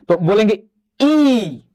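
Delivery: slow teacher demo of ई, vowel held long; slow ई model; drawn-out vowel ई. Shown as a demonstration. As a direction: hold ई out for pupils to copy. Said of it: "आप लोग बोल के try करिए".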